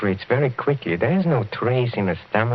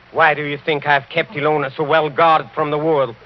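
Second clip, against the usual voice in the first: normal talking voice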